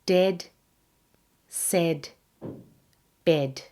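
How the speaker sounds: Dead, said, bed all with short "eh". Shown as a demonstration. Teacher having learners listen, Dead, said, bed with short e. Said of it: "pronounced with a short e".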